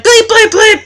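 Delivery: high, loud bleeped-out swearing; mock cussing. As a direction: spit out the bleeps like curses. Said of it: "I would've just been like".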